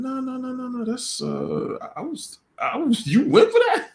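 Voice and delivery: high, laughing mock voice of the narcissist. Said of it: "the narcissist is saying".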